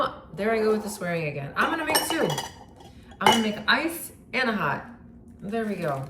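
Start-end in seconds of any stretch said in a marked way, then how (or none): 1.6-4.8 excited. Real, not acted. Repeats decision, high pitch.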